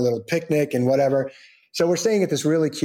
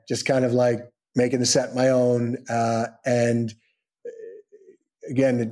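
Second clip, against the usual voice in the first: ordinary talk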